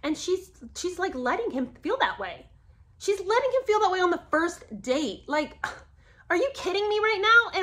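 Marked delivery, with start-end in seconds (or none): 3.0-7.6 high, loud disbelief; are you kidding me, up high; indignant squeal at her nerve; outraged. Real, not acted. Outraged at how she treats him.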